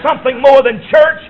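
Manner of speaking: climactic shout. Real, not acted. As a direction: lift it up with passion.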